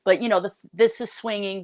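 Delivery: ordinary delivery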